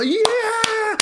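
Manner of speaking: very high whooping yells of joy; crowd cheering. Retold or imitated. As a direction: cheer like a packed theater erupting. Everyone's just freaking out.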